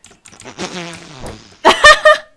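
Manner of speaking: hearty laugh out loud; loud burst of laughter. Real, not acted.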